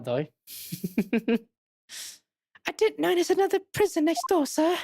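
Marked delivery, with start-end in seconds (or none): 2.7-4.8 much higher, put-on polite voice; high polite character. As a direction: answer timidly high, as a game character. Voicing a polite game character's reply.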